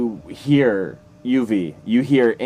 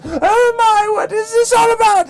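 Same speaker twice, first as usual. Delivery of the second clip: very high, loud hammed-up scream; madam screaming; 6 dB louder than usual. Madam sees chaos on her stage, screaming in shock. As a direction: wail it in a shrieking madam voice. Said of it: "she just screams".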